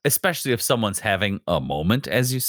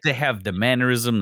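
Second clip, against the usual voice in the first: ordinary talk, nothing marked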